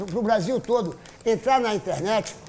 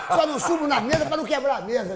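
ordinary delivery

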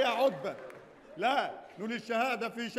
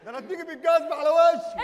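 loud, higher-pitched shouted threat; angry threat; 4 semitones higher, 8 dB louder than usual. Acted. Man threatens the woman with a shoe, shouting. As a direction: yell it as a warning.

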